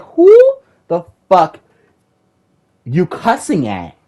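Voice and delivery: who are you cussing at, fired up; angry shout. Retold or imitated. Streamer yelling back at a cussing teammate, loud and outraged. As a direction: yell it high and loud, outraged. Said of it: "and I was like".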